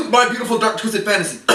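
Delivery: words hurried out inside a fake cough; fake-cough aside. Slipping an album title to viewers, disguised as coughing.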